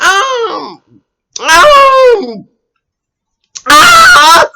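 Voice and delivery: exaggerated, rising drawn-out "um"; high, loud mock hesitation noises; mimicked stalling. Mimics her stalling before answering.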